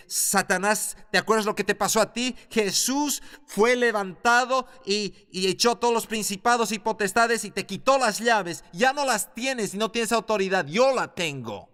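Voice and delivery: high, loud declaration of authority; bold shout. Retold or imitated. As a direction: tell the devil off to his face. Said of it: "se para con esa autoridad y dice". (el creyente le grita a Satanás con autoridad)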